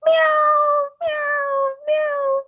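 high insistent hungry meows; whiny high cat demanding food. Retold or imitated. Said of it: "my cat goes like".

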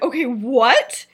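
drawn-out, emphatic "Okay, what?"; slow, loud, questioning what; loud disbelief. Acted. Marlene answering James, loud disbelief. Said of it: "she said it loudly".

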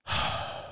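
long breathy sigh. A narrator's reading. Father pausing in talk with daughter, sighing. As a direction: let out a long, heavy breath. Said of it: "He sighed".

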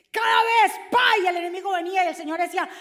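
grita y acelera, más agudo; grito rápido. Real, not acted. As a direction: arranca a gritos, atropellado. (grito veloz de predicador)

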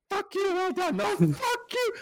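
high, strained mock argument; hammed-up indignant cursing; raging waiter. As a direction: argue back in a comically high yell. Acted waiter demanding forgotten order.

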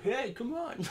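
high laughing voice for the bull; playful bull voice. Retold or imitated. Imagined bull calling to the cow, high and laughing. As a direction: squeak it out as a teasing animal. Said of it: "that's what was going through my mind".

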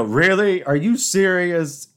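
high, loud "Really?" then a slow "Are you serious?"; mock disbelief. Answering a sweepstakes scam call, loud mock disbelief. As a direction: throw it back at the scammer in disbelief. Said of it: "I just go".

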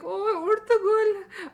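high, loud, stretched-out mock sigh of adoration; swooning mothers. Mothers adoring their Turkish drama hero, loud high mock swoon. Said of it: "All the mothers were like".

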